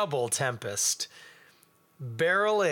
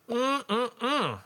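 savoring hum; 8 semitones higher than usual. Real, not acted. Tasting the beer.

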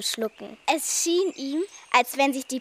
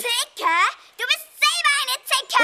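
much higher, loud whiny screech; screeching witch; 13 semitones higher, 5 dB louder than usual. Acted. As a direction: shriek like a bratty witch. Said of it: "Sie kreischten sich an".

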